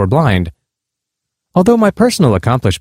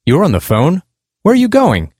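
higher, mildly indignant questions; a touch of disbelief rising into a demand; lightly voiced indignant stranger; indignant, raised voice; 6 semitones higher than usual. A narrator's reading. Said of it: "Raising his voice and using a highly indignant tone".

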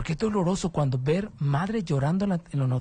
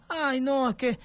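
higher mock whine of a doting mother; whiny mother; 9 semitones higher than usual. Radio host mocking the mother who shields her sons, high whine. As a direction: pitch up and whine her excuse.

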